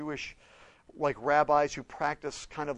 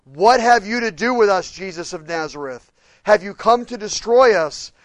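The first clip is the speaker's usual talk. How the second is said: demon's cry; 5 semitones higher, 13 dB louder than usual. Acted. Demon's cry quoted in sermon.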